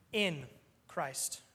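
"in" given a firm stress, then Christ. Shown as a demonstration. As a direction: lean on the word "in". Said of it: "why I emphasize the word "in".

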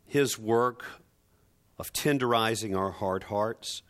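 hushed, low and reverent prayer voice. Acted. Pastor leading the congregation in prayer, low and hushed. Opening prayer before the sermon.

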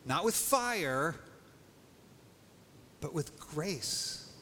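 slow, every phrase weighted; slowed-down emphatic preaching; dramatic slow build. Acted. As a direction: hold back, then land on grace. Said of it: "now catch this".

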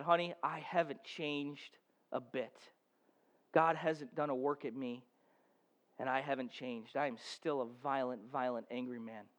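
hushed, broken voice of a weeping man; barely above a murmur, as if beaten down by guilt; broken confession. Retold or imitated. Farmer confessing to his wife on the kitchen floor, hushed and broken.

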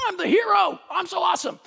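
high, boastful mock voice; mock braggart. Preacher mocking a self-impressed person, high mock boast. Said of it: "Nobody's walking around going".

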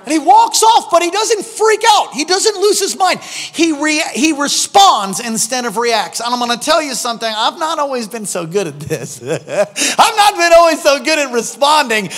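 high, loud, driving sermon delivery; full-force preaching. Real, not acted. Preacher telling how he responds instead of reacts, impassioned preaching.

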